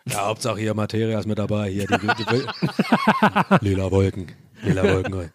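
deep, low rapper impression; low, lazy Rostock rapper voice; [name]. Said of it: "dein [name] wie".

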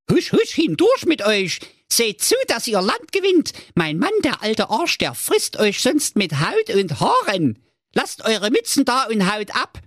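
high, urgent shooing wife; ogre wife. Acted. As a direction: hurry the kids away, high and brisk. Ogre wife shoos children out.

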